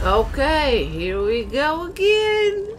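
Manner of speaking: slow, stretched-out weary sigh of a line, rising high; exasperated. Real, not acted. Streamer dragging out his weariness at yet another quest. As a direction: groan it like yet another chore.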